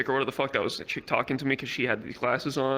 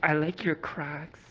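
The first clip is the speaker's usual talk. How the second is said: stripper's come-on; slower than usual. Retold or imitated. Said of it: "comes up to him and goes".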